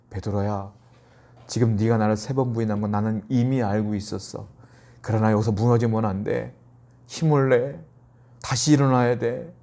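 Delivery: low, softened voice of gentle understanding; quietly steady a friend who has fallen; acted-out loving reassurance, kept low; tender compassion. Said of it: "안타까움의 눈빛으로 베드로를 보는 거예요".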